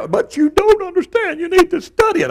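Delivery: squeaky scolding expert voice; mock lecturing voice. Pastor parodying someone lecturing him, squeaky. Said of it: "you can tell me".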